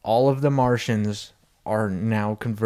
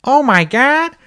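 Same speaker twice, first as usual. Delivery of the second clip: high, loud cartoon wife impression; hammed-up shock, pitched way up; Lois; 12 semitones higher, 8 dB louder, slower than usual. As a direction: exclaim it in a high put-on voice. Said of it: "Lois is like".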